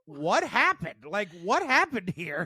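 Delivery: high-pitched baffled exclamation; bewildered. Retold or imitated. Pet owner recalling coming home to the mess, bewildered. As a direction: act out baffled disbelief, voice lifted.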